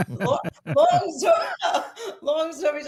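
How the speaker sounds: high, loud teary laugh, drawn out; half laughing, half crying over the memory; laughing through tears. Real, not acted. Emotional teacher recalls a student.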